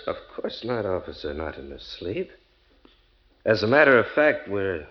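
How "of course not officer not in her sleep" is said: low, soft, reassuring murmur; smooth denial. Acted. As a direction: quietly placate the officer. Husband placating policeman.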